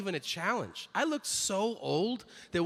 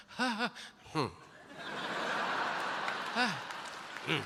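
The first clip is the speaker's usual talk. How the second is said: choppy stuttering moans; 4 semitones higher, 5 dB quieter than usual. Retold or imitated. Imitating buffering porn audio.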